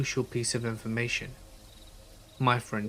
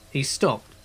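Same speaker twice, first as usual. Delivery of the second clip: ordinary delivery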